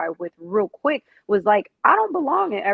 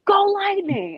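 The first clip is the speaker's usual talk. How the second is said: high, drawn-out team shout; fan cheer; 11 semitones higher, 4 dB louder, slower than usual. Retold or imitated. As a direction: call it out to a fellow fan. Imitating fans calling to each other.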